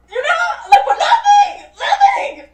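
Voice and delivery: very high, squealing indignation; comic outraged shriek; exasperated. Real, not acted. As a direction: squeal it out, half laughing. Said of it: "I'm fucking pissed".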